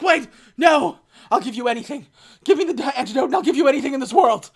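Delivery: high, loud, hammed-up begging; mock-panicked bargaining; frantic pleading. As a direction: plead desperately, rushing by the end. Acting H begging for the antidote.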